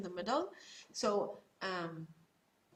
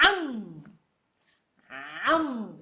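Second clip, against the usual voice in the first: put-on louder coaxing am, am for a baby; airplane spoon-feeding; 4 dB louder than usual. Parent coaxing a baby to eat, playful am am. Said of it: "and you tell him".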